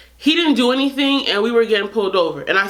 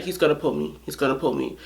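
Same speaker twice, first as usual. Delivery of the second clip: nervous mutter; 5 semitones lower, 6 dB quieter, faster than usual. Retold or imitated. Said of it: "And he's just like".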